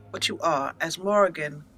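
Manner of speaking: ordinary talk, nothing marked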